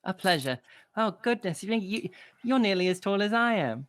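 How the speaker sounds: put-on a small girl owning up to the barkeep; little girl apologizing for the bird. Little girl marvels at halfling.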